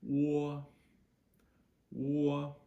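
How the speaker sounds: O as "waw", high and level. Shown as a demonstration. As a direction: hold O loud as a New Jersey "waw". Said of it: "exactly how we pronounce O".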